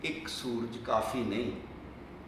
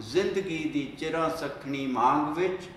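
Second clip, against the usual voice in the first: declaimed poem, louder than plain talk; poem recitation; 4 semitones higher, 9 dB louder than usual. Acted. Poet to young comrades about oppression, impassioned poem recital. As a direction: recite in measured lines, lifting the rallying calls.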